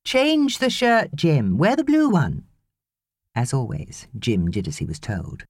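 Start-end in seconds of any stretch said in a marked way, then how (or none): 0.0-2.4 called back. A narrator's reading. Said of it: "she called back".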